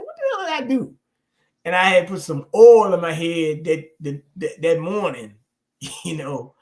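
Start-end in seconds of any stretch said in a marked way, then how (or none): 0.0-0.9 much higher, startled cry; mock panic. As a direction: squeal it like you're fleeing a wasp. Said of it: "I said".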